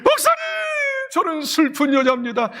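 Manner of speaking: high sobbing call easing into confession. Acted. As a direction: act out crying to the pastor. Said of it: "아마 흐느꼈을 거예요". (흐느끼는 고백)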